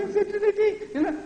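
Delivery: tiny, very high falsetto; mocking chicken voice. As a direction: squeak the warning out meekly in parody. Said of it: "that chicken voice".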